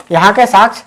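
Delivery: ordinary talk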